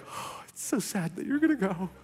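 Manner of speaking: mock sorrow with a laugh breaking through; fake sadness. Pastor to departing staffer, faking sadness through a laugh. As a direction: pretend to be sad but can't hide the laugh. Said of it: "I'm trying to be like".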